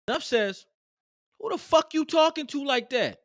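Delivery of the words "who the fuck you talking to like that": high, heated mock confrontation; indignant retold challenge; angry retort. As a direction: fire back at the boss. Teller confronting manager.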